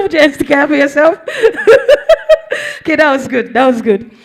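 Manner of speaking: bursting out laughing; teacher cracking up after the class whisper. Real, not acted. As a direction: laugh through the words.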